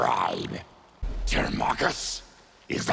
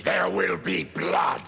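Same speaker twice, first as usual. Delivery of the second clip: snarling warning to the Empress, shouted; angry threat; 13 semitones higher than usual. Acted. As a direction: let the rage break out on blood.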